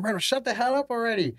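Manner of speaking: high-pitched, fed-up retort; retold exasperation. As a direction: imitate an annoyed teammate snapping. Retold fed-up teammate during punishment exercise.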